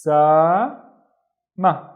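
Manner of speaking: "Usama" very slowly, in three separated syllables; syllable-by-syllable reading. Shown as a demonstration. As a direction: sound out "Usama" with long gaps, stretching the middle. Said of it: "this is how my name is written".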